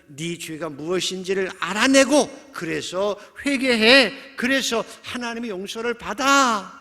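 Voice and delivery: loud, high-pitched preachy admonishment; admonishing friend. Acted. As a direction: press the point hard, urging repentance. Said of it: "충고하는 거예요". (높고 큰 목소리로 다그치는 충고)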